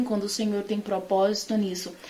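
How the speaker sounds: usual speaking voice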